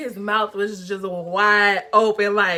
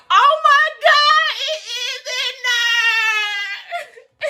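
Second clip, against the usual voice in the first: high, loud, drawn-out horrified cry; shocked shriek; 13 semitones higher, 5 dB louder, much slower than usual. Retold or imitated. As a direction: shriek it in disbelief, half-laughing. Discovering the plug is stuck.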